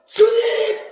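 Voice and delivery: long, high, very loud call to God; crying out. Acted. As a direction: draw the word out in a full acted shout. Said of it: "주님한테 소리 질러댄 거야". (길게 목청껏 부르짖음)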